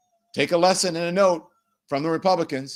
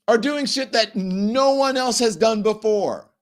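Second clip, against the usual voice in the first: high, strained, incredulous rant; exasperated scolding; 10 semitones higher, 3 dB louder than usual. Real, not acted. Host berating Democrats for infighting during a crisis, exasperated scolding. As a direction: berate them as if they have lost their minds.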